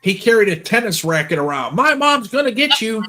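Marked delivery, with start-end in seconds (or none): 1.8-3.0 mock taunt. Mocking a wrestler's taunt.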